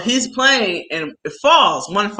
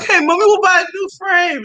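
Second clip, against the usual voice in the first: laughing through words; 12 semitones higher, 4 dB louder, faster than usual. Real, not acted. Laughing while retelling.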